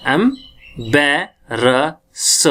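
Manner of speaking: "embarrass" extremely slow as am, bae, ra, sa; sounding out syllables. Shown as a demonstration. Teacher breaking down the word, "embarrass" syllable by syllable. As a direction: leave long gaps inside "embarrass". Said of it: "तो अब क्या sound आएगी".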